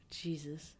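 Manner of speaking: breathy whispered aside. Real, not acted. Whispered reaction.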